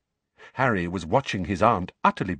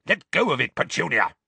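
understated roar, pitched up; mild roar; 7 semitones higher, 4 dB louder than usual. A narrator's reading. Uncle warning his wife off the letter, mild roar. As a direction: bark it as a contained roar. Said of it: "roared Uncle Vernon".

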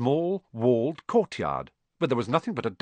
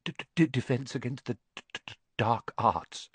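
soft, fast, nervous stutter; stammered mutter; 5 dB quieter than usual. Acted. Quirrell naming his subject, soft nervous stammer. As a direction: mumble it away anxiously. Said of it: "muttered Professor Quirrell".

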